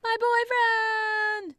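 very high, slow, drawn-out horrified shriek; horrified yell. Acted. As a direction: stretch the scream out in horror. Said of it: "a horrified Mercedes yells".

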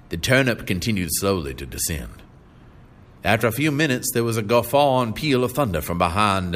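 usual speaking voice

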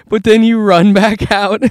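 ordinary delivery

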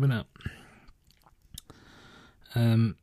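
ordinary talk

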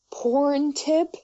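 high, questioning cartoon-bear voice; Winnie the Pooh. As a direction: puzzle over the name as a storybook bear. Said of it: "in the Winnie the Pooh voice".

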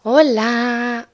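ordinary talk

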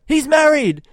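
high, loud mock yell. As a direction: holler it across the table like your mate. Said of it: "he just yells out".

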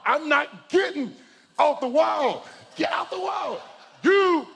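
high, loud desperate yelling at the lifeguard; panicked yell. Retold or imitated. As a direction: yell it like you're about to drown.

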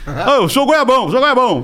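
a lightly put-on, sing-song "goiabão" mocking himself; self-mocking sneer. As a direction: play the fool they called him, half-laughing. Mocking the insult thrown at him.

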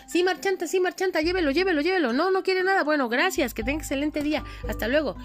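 high, bright stall-keeper calling customers; market vendor voice. Acted. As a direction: sell to passing shoppers.

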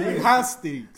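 loud laughing retort; laughing loudly. Real, not acted. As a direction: laugh hard through the insult.

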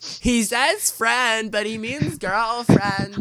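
put-on high, slow teasing girly voice; girl impression. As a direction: mimic giggly little sisters, drawn out. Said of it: "your girl impression".

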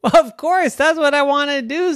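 put-on squeaky indignant child; high exasperated girl. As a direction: huff it out, high and loud. Girl answers walrus.

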